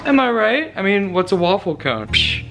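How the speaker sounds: ordinary delivery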